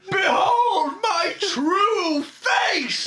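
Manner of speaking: high, slow, laughing shout; hammy villain reveal; villain proclamation. Pretend.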